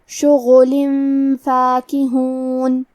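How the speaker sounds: very slow Quranic drawl of شغلن فاکہون with both vowels even; tajweed demo. Shown as a demonstration. Teacher shows students the tajweed, very slow. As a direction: slowly sound out شغلن فاکہون, giving each vowel equal length. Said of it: "ہم یہ دونوں پیش کو برابر سے ادا کریں گے".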